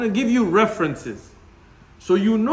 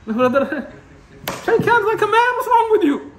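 higher, louder scolding retort; mock scolding; 10 semitones higher, 3 dB louder than usual. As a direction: bark at a limp brother to man up. Scolding a limp handshaker.

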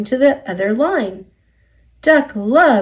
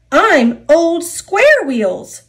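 high, loud, slow indignation; fuming; 8 semitones higher, 6 dB louder, slower than usual. A narrator's reading. Henry complains of his nickname, fuming. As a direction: drag out each word in storybook outrage. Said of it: "fumed Henry".

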